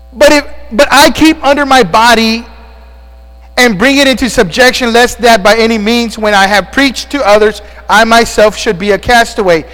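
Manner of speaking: loud, high preacher reading; preaching scripture. Acted. Pastor reading Corinthians to his congregation, preaching. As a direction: read it out with pulpit force.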